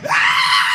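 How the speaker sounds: very high, loud shriek of joy; triumphant scream. Acted. Pupil praised by teacher, wild inward victory scream. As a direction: scream with inner celebration. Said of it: "Inside, I like this".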